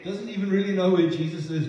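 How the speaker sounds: usual speaking voice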